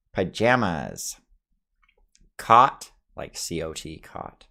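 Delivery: normal talking voice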